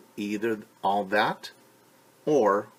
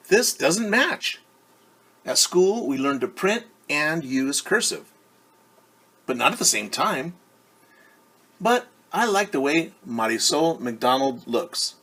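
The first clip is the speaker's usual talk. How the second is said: teacher voice; 5 semitones higher, 8 dB louder than usual. Acted. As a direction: scold gently like a fussy teacher. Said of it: "she says".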